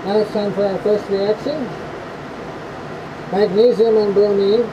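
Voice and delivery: muffled, speaking through a respirator mask. Real, not acted. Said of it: "I'm all geared up in the respirator".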